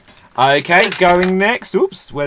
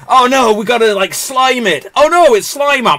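loud, raised pretend panic; high, urgent cartoon alarm; mock alarm; 9 semitones higher, 8 dB louder than usual. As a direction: cry out as a hammed-up ghost hunter. Acts alarm in film retelling.

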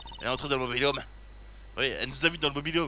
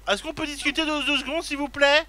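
much higher, mock begging voice; high pleading; 10 semitones higher, 3 dB louder than usual. Detective begging the witness, high and pleading. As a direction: beg in a squeaky high plea.

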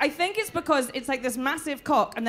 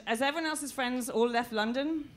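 ordinary talk

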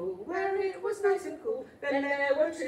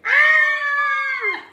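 playful scream; pretend scream; 13 semitones higher, 12 dB louder than usual. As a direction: scream for fun. Don't forget to scream.

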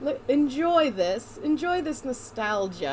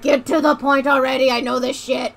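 loud impatient outburst; mock impatience; 7 dB louder than usual. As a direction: push it out louder, hurrying them along. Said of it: "those of us who are like".

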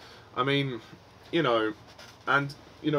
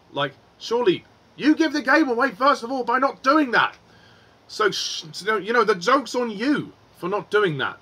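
much higher and louder, incredulous ranting; exasperated outburst; 8 semitones higher, 5 dB louder than usual. Real, not acted. Reviewer scolding the film's villains, loud incredulous rant. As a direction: shout it at the villains like they're idiots.

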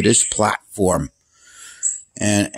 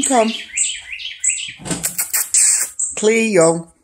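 coaxing the cat outside, raised and high; calling the cat; 7 semitones higher than usual. Real, not acted. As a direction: call her out sing-song and up high.

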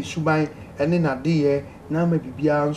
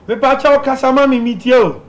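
louder and higher, calling down a bad phone line; 8 semitones higher, 7 dB louder than usual. Real, not acted. Host calling out to a caller on a bad line, loud. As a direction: raise the voice so the caller can hear.

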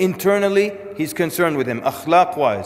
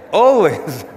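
louder, swaggering "always" in a tough-guy voice; arrogant tough guy; 3 semitones higher, 6 dB louder than usual. Shown as a demonstration. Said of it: "I'll give you a demo".

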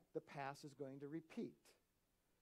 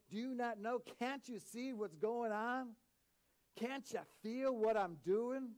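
impassioned questioning; 7 semitones higher, 5 dB louder than usual. Acted. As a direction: press each question home, pitched up. Acts out God's questions.

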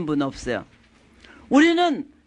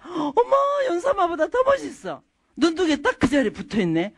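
gushing mock praise; 7 semitones higher than usual. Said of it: "이쁜 반대말을 많이 할수록".

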